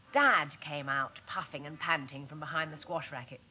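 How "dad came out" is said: usual speaking voice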